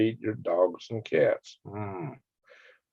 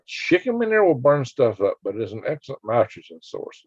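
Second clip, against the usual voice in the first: ordinary talk, nothing marked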